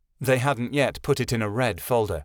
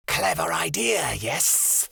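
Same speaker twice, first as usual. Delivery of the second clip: slow, high hissing snake voice; hissing cobra; 5 semitones higher, much slower than usual. Acted. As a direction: hiss it like a smug cobra. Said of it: "the cobra hissed".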